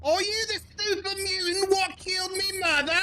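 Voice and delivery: high, put-on bad Cockney accent, a bit soft. Streamer in a game doing a bad Cockney accent on purpose. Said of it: "that's why I said Cockney accent".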